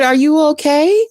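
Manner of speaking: high-pitched question, rising on "okay"; retold concern with a lifted, questioning end; concerned neighbor. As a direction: ask it as a worried neighbor checking in. Quoting the worried neighbor.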